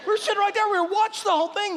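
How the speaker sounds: tumbling out fast and high, amazed; excited retelling. Retold or imitated. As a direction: rattle it off in amused disbelief. Retelling the officers' amazed report.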